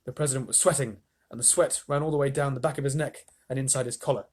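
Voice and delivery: usual speaking voice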